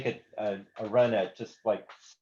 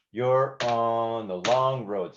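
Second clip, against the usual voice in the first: long road weighted, slow; slow, sung-like; stress on long road; 3 dB louder, much slower than usual. Shown as a demonstration. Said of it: "the emphasis on the word long road".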